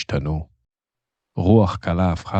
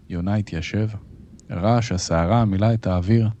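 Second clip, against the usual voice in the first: ordinary delivery, nothing marked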